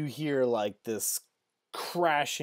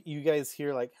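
ordinary talk, nothing marked

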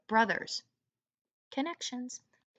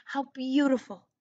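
a higher, louder cry of wonder; lightly voiced amazed son; delighted cry; 6 semitones higher, 5 dB louder than usual. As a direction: gasp at the beauty of it. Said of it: "he cried".

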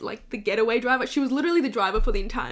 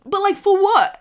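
loud, high, incredulous "for what?" with "for" hit hard and falling; exasperated; 7 semitones higher, 8 dB louder than usual. Real, not acted. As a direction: throw the question out in disbelief. Exasperated rhetorical question on beauty standards.

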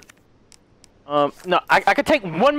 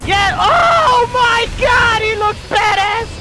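high, loud, drawn-out shout; hyped-up high-pitched cheering; awed excitement; 13 semitones higher, 4 dB louder, much slower than usual. Real, not acted. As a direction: yell in thrilled amazement. Awed by the transformed boss.

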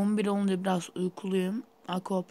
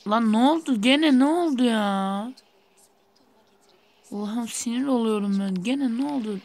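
loud, higher, frustrated yelling at the game; exasperated outburst; 4 semitones higher, 8 dB louder than usual. Real, not acted. Gamer yelling at the bus game, loud and fed up. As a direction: blow up at yet another crash.

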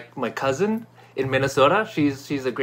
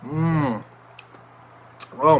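usual speaking voice

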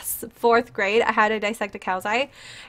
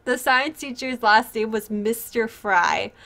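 usual speaking voice

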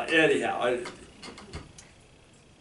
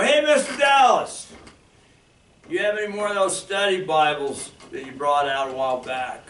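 loud mock greeting; 5 semitones higher, 12 dB louder than usual. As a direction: call out Hey, Mister Dallas from across the room. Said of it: "You go".

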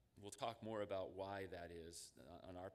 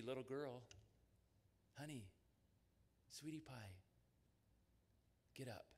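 put-on soft, gentle call to a child; gentle, tender; 2 semitones higher, 6 dB quieter than usual. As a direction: coax her awake tenderly. Tender resurrection words to child.

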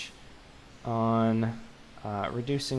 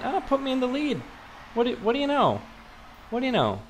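pleased surprise; 11 semitones higher, faster than usual. Real, not acted. Takes the lead in polls.

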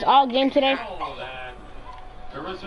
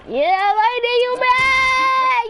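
high, sing-song "you mad" taunt, pitched way up; gloating taunt; 10 semitones higher, much slower than usual. Real, not acted. Gamer taunting teammate London over stolen kills, high gloating sing-song. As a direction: needle him, voice riding high.

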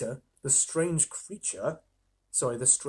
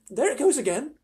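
put-on high-pitched girl on the lookout; Susan's girlish voice; 9 semitones higher, 3 dB louder than usual. A narrator's reading. Susan sees the thing move, high girlish voice.

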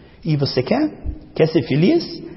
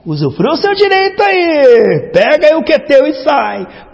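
high, louder, slow mocking send-off; sarcastic go-ahead, louder and higher; mocking dismissal; 10 semitones higher, 6 dB louder, slower than usual. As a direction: shoo them off with sarcasm. Mocking the sad listener.

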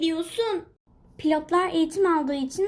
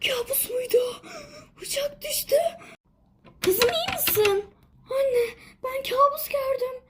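shaken whimper; 7 semitones higher than usual. Acted. Waking from nightmare upset.

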